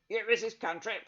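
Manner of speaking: loud, barked question across a crowd; brawny man shouting over a noisy shop; gruff shout. Acted. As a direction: call her out over the hubbub.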